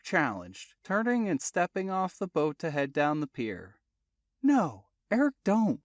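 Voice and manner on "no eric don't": higher, louder urgent warning. Acted. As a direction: call out to stop him. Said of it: "Jesse warned".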